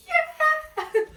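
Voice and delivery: very high, loud whoop; re-enacted cheer. Birthday girl answering the crowd, high re-enacted cheer. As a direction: whoop it back in celebration.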